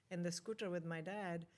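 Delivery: normal talking voice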